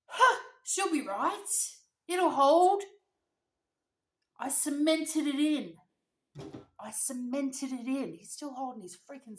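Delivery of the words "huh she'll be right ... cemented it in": high, loud, drawn-out reassurance; dad shouting confidently. Retold or imitated. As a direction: holler it over a storm like a stubborn dad. Dad insisting over the storm.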